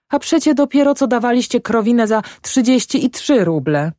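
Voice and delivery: high-pitched housewife objecting; acted peasant wife, high and indignant; protesting wife.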